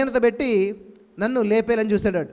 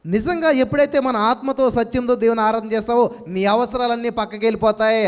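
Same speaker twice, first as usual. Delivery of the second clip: ordinary delivery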